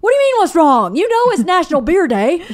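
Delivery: high, loud mock tantrum; hammed-up indignant complaint; outraged husband. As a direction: imitate a petulant outburst, pitch climbing.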